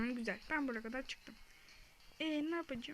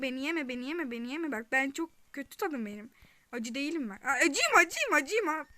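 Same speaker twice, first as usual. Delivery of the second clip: loud frantic bargaining; half-joking panicked pleading; loud comic pleading; 2 semitones higher, 9 dB louder than usual. Real, not acted. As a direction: beg the monster not to eat you. Pleading with enemy not to eat.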